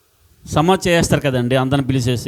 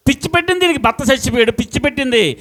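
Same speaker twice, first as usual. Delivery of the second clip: much higher, scoffing neighbour voice; mocking gossip; 9 semitones higher, faster than usual. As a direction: sneer that she has gone mad. Mocking neighbours call widow mad. (విధవను ఎగతాళి చేస్తున్న ఇరుగుపొరుగు, చాలా ఎత్తైన వెక్కిరింత స్వరం)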